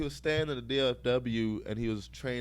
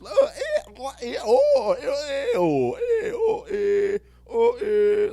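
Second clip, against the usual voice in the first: very high, loud barking seal-like "oh, eh"; seal noises; 10 dB louder, much slower than usual. Retold or imitated. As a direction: bark the sounds out like a seal, building to shouts.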